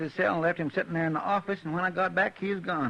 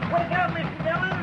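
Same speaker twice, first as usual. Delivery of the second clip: urgent high yell from outside; calling out; 12 semitones higher than usual. Acted. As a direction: shout to wake him. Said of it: "stop the racket".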